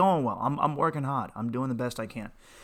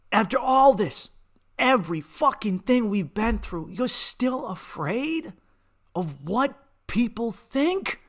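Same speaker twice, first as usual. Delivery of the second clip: loud, high and dragged out word by word; slow furious shout; 12 semitones higher, 6 dB louder, slower than usual. Retold or imitated. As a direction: hammer each word at your mother. Yelling at mother, slow.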